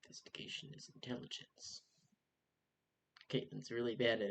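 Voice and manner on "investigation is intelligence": whisper. Real, not acted. Rolling, whispered aside.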